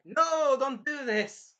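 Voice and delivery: high, loud acted cry; mock alarmed plea. Ren begging the villain to stop, mock alarmed plea. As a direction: call out to stop someone, hammed up.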